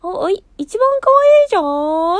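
a hint of Rapunzel preening; voicing Rapunzel. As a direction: play the princess bragging about herself. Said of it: "ラプンツェルが".

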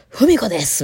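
theatrical stage voice. Retold or imitated. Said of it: "なんでそんな演技するん".

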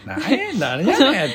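slow, laughing Kansai scoff; mock Kansai scoff. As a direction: drag out a bemused Osaka retort. 関西人を真似る. (笑いながらゆっくり関西弁で呆れる)

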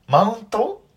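high, pointed mocking question; sharp question. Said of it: "マウント取りに来たの？って意味で".

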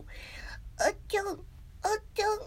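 tiny, high, squeaky mock voice. Streamer showing an actress's joke voice, high and silly. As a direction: squeeze out high silly chirps. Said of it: "あの、ふざけた声".

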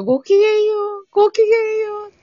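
very high, quick singsong greeting; genteel schoolgirl greeting. Retold or imitated. Recalling how girls greeted each other at school, high genteel imitation. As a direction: trill it high like a refined schoolgirl. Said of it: "ってやってたよ".

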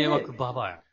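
muttered-out insult in mock fury; comic anger. Real, not acted. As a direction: grumble the insult like you're fed up. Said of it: "めっちゃ怒ってるやん". (怒り気味の悪態)

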